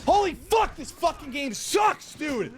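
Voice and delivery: high-pitched gamer rage; angry yelling. Real, not acted. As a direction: scream at the broken game. Raging at game.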